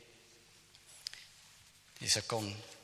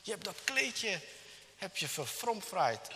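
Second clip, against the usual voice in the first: high-pitched mock reproach; raised, accusing mother's voice; acted scolding; 8 semitones higher than usual. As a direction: play a mother telling off her son. Mother scolds son in story.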